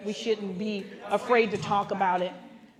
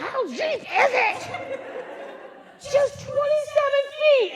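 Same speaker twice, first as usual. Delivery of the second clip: panicked. Retold or imitated. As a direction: let the fear climb. Panicked exchange with guide.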